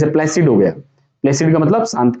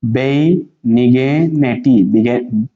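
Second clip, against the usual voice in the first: very slow, stretched "बेनिग्निटी"; syllable by syllable; 4 semitones lower, much slower than usual. Shown as a demonstration. Teacher showing students how to read it, slowly in syllables. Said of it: "अब ये क्या है".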